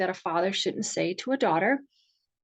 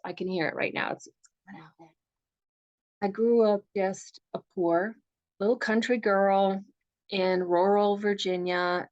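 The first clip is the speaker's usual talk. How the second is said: ordinary talk, nothing marked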